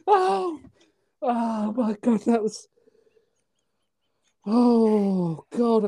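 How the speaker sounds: usual speaking voice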